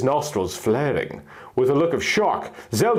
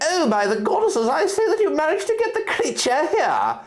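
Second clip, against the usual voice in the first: high, airy little fairy voice. Acted. Lily the fairy greeting the narrator, high and eager. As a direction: lift it up into a tiny fairy's excited chatter.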